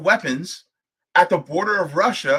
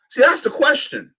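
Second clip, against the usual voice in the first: usual speaking voice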